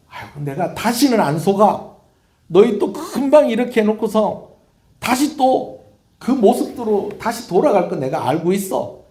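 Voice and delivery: high, loud, fed-up rebuke; exasperated God. Acted. God to a people who keep backsliding, exasperated. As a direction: scold them for their empty promises. God voiced refusing to be fooled.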